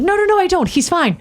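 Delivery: high, hurried-sounding mock denial; retold protest. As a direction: protest in a high voice, imitating yourself.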